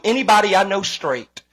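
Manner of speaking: ordinary delivery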